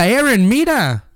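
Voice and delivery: high, sharp imitated cry of alarm; wife yelling a warning while he drives; retold wife's yell. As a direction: call out a warning to the driver, mildly. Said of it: "Y me grita".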